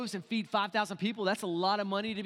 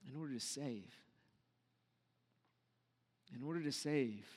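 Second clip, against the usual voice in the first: hushed; 6 semitones lower, 9 dB quieter than usual. Real, not acted. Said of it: "repeated softly for weight".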